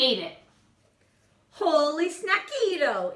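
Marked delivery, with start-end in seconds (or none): 1.5-3.1 high, loud gleeful cry; exclamation. A narrator's reading. Kitten exclaiming after snapping up a fly. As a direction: shout it after eating a fly. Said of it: "exclaimed the kitty boy".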